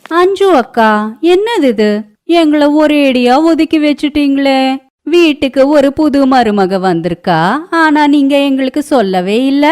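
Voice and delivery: high, loud, teasing neighbour woman voice; a gossiping neighbour poking fun, pitched high; nosy neighbour. Acted. (பக்கத்து வீட்டுப் பெண் கிண்டலாக, உயர்ந்த குரலில்)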